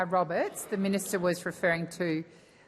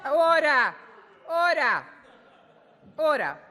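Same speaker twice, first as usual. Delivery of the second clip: loud, high call for order over heckling; 9 semitones higher, 6 dB louder than usual. Real, not acted. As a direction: raise your voice to restore order in the chamber. Speaker calls heckler to order.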